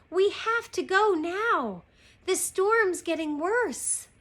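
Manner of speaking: high, slow, insistent plea; urgent pleading. Acted. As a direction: press each word to get a companion moving. Annie urgently begs to leave.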